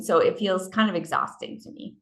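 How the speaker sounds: ordinary delivery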